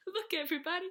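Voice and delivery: high, loud call to all. Acted. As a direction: call out to the room. Calling out to everyone.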